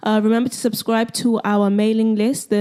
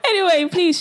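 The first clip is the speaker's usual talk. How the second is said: laughing through words; giggling as she talks. Real, not acted. Host laughing at banter.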